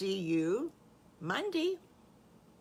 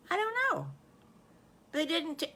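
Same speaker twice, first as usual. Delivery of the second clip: put-on very high small-child voice; high-pitched innocent puzzlement; unsure little wolf; 10 semitones higher, faster than usual. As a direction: answer in a tiny, high, uncertain voice.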